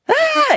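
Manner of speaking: high, loud imitated shriek; acted-out scream of a kid losing it; panicked scream. Kid getting a belt whipping, panicked scream. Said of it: "losing it, like, going crazy".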